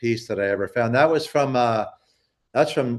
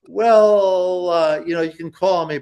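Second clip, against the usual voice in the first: drawn-out, higher-pitched reluctant "well"; retold hesitant reply; 7 semitones higher, 4 dB louder than usual. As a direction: imitate someone hedging on the phone. Retold reluctant answer to collector's request.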